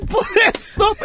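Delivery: breaking into laughter; cracking up while doing the Russian dad bit; laughing. Real, not acted. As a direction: laugh through the plea.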